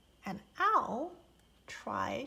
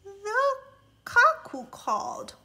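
plain reading voice

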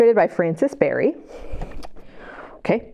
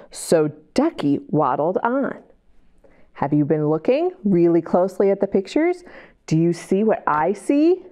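put-on reading to children. A narrator's reading. Reads picture book aloud.

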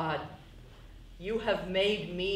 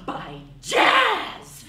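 insisting shout; 8 dB louder, slower than usual. Acted. Carol insisting loudly.

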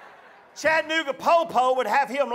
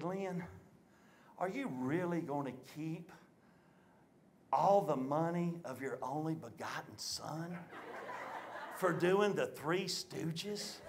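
very soft, low imitation of mama; hushed reproach; 6 semitones lower, 14 dB quieter than usual. Mama confronting father over his only son, hushed reproach. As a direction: draw out the quiet question, measured and low.

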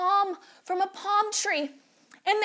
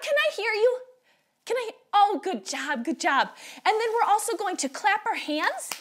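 ordinary delivery

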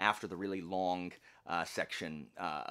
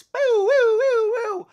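very high, loud, drawn-out whoops; fanfare cheer; 18 dB louder, slower than usual. Real, not acted. Booktuber announcing a bonus read-along to viewers, whooping. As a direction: whoop it like a game-show fanfare.

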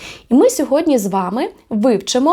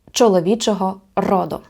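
normal talking voice

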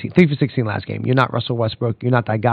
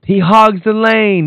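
mocking Mitchell's excuse; 8 semitones higher, 4 dB louder, slower than usual. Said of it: "You, you sound like Cat".

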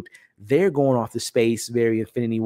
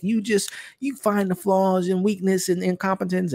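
higher, lightly voiced scolding; a hint of a little sister snapping back; defending Mantis; 8 semitones higher than usual. Mantis scolds Nebula for mocking Drax, higher lightly voiced reproach. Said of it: "she kinda comes at Nebula like".

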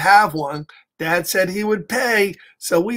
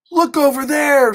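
put-on excited child; 7 semitones higher, 3 dB louder than usual. Sister Kay points out rabbit.

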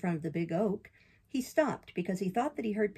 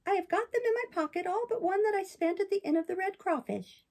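high, bright-eyed puppet, much higher than the narrator; puppet boy voice; 11 semitones higher than usual. Acted. Pinocchio protests to the fairy in a high boyish voice. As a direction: play Pinocchio as an eager young boy defending himself.

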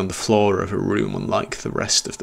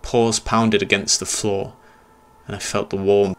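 ordinary delivery, nothing marked

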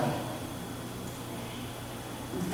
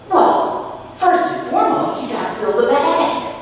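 high, louder bright explaining; chirpy clown lady; 8 semitones higher, 5 dB louder than usual. Retold or imitated. Clown lady explaining the rules to the boy, chirpy. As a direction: sell the rules like a cheerful clown.

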